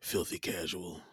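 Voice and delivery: low, soft, slow mock scorn; mock disdain. Co-host teases about the casual fan, mock disdain. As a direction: mutter a teasing insult slowly.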